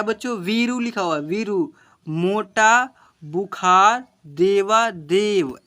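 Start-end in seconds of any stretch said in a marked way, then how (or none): 2.1-5.6 slow reading aloud. Shown as a demonstration. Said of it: "ऐसे पढ़ेंगे".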